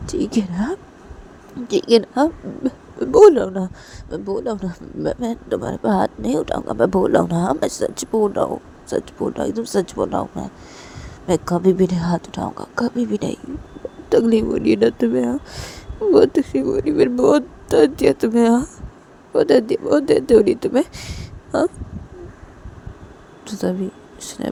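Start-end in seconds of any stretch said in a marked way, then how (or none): 0.1-13.6 remorseful partner pleading to be believed; apologetic Disha. Acted. 14.1-21.7 loud, anguished concern; guilt-stricken, raised-voice sympathy; remorseful Disha. Acted.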